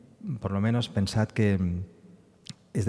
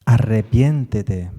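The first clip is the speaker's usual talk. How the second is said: loud, slow, emphatic "arrepiéntete"; urgent plea; 9 dB louder, slower than usual. Acted. Preacher voicing the psalm's plea to the Lord, loud and slow. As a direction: call out "arrepiéntete" slowly, pleading hard.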